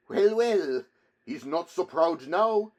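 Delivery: high, loud mocking crowd voice; loud, high-pitched taunt; jeering onlookers. A narrator's reading. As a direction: crow over him like a gloating bystander.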